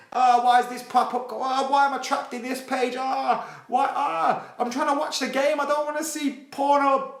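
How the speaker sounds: high, whiny mock voice of a trapped viewer; frustrated viewer. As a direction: whine like someone stuck in pop-ups. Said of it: "clicking off ads and shutting down porn pop-ups".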